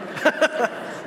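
high unvoiced burst of laughter; real laugh. Real, not acted. Priest laughing at his mom's answer, a high breathy laugh. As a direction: let a real laugh escape.

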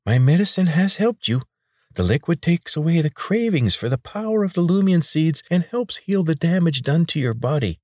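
high, oily voice of a chubby healer; pudgy man. A narrator's reading. Chubby healer coaxing the injured boy, high and oily.

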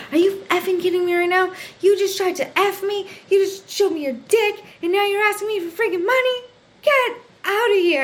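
high-pitched, rapid indignant rant; retold outrage. Retold hallway blow-up at the man.

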